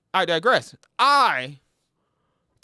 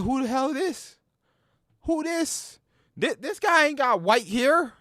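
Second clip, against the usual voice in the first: higher, mock-baffled who is this; incredulous; 7 semitones higher than usual. Gamer reacting to the young Dante, higher and incredulous. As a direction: squint at the screen in disbelief.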